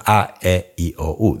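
five pure Spanish vowels, A, E, I, O, U. Shown as a demonstration. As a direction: say A, E, I, O, U as short pure Spanish sounds. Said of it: "There are five sounds".

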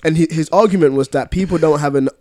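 ordinary talk, nothing marked

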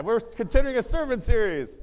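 high, loud rallying shout; hyped-up retry. Shown as a demonstration. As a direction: shout it to fire up the congregation. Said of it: "We'll try it again".

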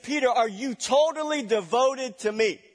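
a hint of an urgent Jesus; pressing question. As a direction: push Peter for total devotion.

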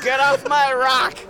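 put-on high, slow, cranky old codger; grumpy old man rattlesnake. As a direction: shake your fist and yell slowly. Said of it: "old man rattlesnake".

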